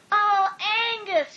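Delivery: breathy lovestruck high voice; dreamy sigh. A narrator's reading. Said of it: "she sighed".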